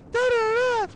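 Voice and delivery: high, loud mock wailing gibberish; hysterical mom babble. Mom ranting at son, high hysterical babble. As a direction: imitate mom carrying on wordlessly.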